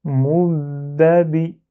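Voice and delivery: "Moubabi" slowly in one unbroken go, first b doubled; whole word at once. Shown as a demonstration. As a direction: hold the b in "Moubabi" without breaking the word. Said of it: "le mot en entier d'un coup".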